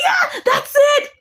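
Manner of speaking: high, slow, gleeful shout; gleeful villain. Retold or imitated. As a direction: crow over the prize. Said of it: "She said".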